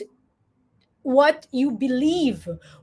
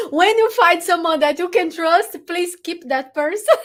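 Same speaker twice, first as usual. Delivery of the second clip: high, loud words through laughter; real laugh spilling into the advice; 6 semitones higher, 5 dB louder than usual. Real, not acted. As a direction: crack up while giving earnest advice. Host cracks up while giving advice.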